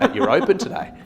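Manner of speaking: laughing through the quote; laughing while retelling the owner's question; laughing retold question. Real estate agent laughing as he retells the owner's question.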